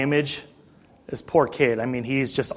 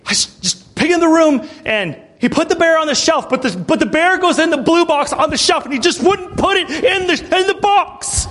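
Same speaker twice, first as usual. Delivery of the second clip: imitating a kid falling apart; 11 semitones higher than usual. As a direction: act out a child losing it, rising to a yell. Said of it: "He just completely fell apart".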